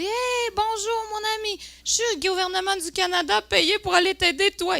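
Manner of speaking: very high, stretched-out singsong hello; over-friendly foreigner greeting. Retold or imitated. Se revoit saluer un étranger. (salutation exagérée, voix haute et étirée)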